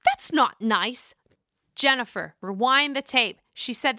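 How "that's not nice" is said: a lightly voiced scolding Margaret; a gentle mock scold. Margaret defending Jennifer against Jackie, mild mock scold. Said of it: "Margaret stood up for you and said".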